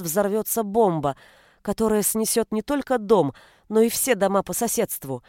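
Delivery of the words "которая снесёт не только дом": ordinary delivery, nothing marked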